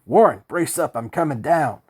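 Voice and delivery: loud, high shout of courage. A narrator's reading. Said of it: "I shouted into the phone".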